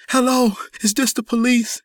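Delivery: high, frantic, distressed voice; hysterical crying. A narrator's reading. Deborah phoning the police, hysterical and high. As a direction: cry out high into the phone, distraught. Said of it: "Crying hysterically".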